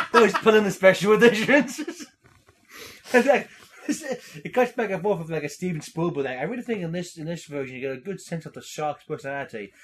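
usual speaking voice

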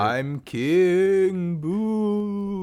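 high, very slow ghostly wail; spooky voice. Acted. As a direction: drag it out like a ghost. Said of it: "a spooky voice".

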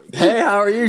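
high, loud sing-song greeting; flirty manager voice. Retold or imitated. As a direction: play her as a seductive boss. Voicing coworker as flirty manager.

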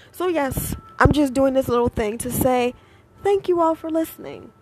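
ordinary talk

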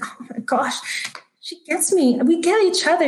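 blissed-out, high-pitched wonder; giddy excitement. Acted. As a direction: gush like you're on cloud nine. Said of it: "feel so free and excited".

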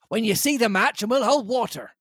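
high, urgent captain voice; captain warning not to swamp the boat; captain's voice. Acted. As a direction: warn the squire like a tense sea captain. Captain's order continues.

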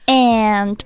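"And" held long before a pause; drawn-out word. Shown as a demonstration. Teacher shows how the singer starts the line, drawn-out "And". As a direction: stretch out "And" as one long vowel. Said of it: "拖得比较长, 有个短暂的停顿".

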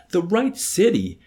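high, loud, slow echo question; baffled, drawn-out query leaping up in pitch. A narrator's reading. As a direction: repeat it back in loud disbelief. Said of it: "Colonel Cathcart was baffled".

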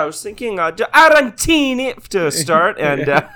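high ordering voice bursting into Italian food names; over-the-top Italian diner, giggling; mock Italian accent. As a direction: blare the dishes in hammed-up Italian. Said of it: "Every time I do an Italian voice".

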